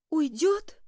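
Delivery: high, loud startled echo; alarmed surprise. A narrator's reading. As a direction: gasp the word back in alarm. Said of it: "Она прижала ладони к груди".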